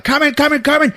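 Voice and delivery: high, eager repeated chant; hammed-up eager dog voice; running dogs. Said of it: "They keep showing these dogs".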